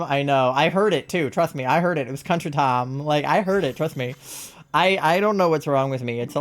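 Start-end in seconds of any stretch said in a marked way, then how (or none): 2.2-3.0 "Tom" for time, with a flattened vowel; Southern-sounding "time". Shown as a demonstration. Streamer owning up to how they said time, "Tom" with a Southern vowel. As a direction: say Tom where Time is meant. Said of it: "It's the way you say time".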